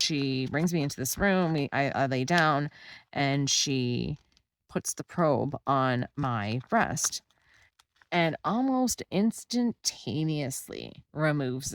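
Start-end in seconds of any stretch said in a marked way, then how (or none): none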